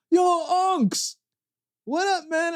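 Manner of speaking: high, cheery street greeting; friendly greeting. Retold or imitated. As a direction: call out to an old friend. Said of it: "one of them is like".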